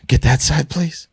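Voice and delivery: high, prissy mock request; imitating the wife. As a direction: ham up her polite ask. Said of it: "left by Edward said".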